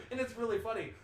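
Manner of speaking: ordinary talk, nothing marked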